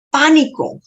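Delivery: Pánico punched up high, falling at the end; emphatic repeat. Real, not acted. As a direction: land hard on Pánico. Stressing her fear of camera.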